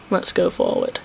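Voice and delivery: whispered choice, scared; barely voiced whisper, nervous. Real, not acted. Scared player whispering the next move in the maze. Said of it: "I know I'm being a fucking pansy".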